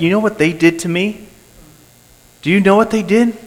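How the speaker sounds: high, eager gossiping whisper-to-a-friend tone; mock a gossip spilling news; gossipy tattler. Preacher mocking a gossip telling others what was done.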